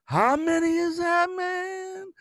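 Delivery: high, slow stoned drawl; stoned guy. Retold or imitated. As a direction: play the spaced-out guy on the phone. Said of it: "he sounds like he's high".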